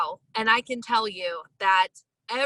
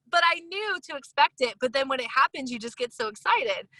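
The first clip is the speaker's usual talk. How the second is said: usual speaking voice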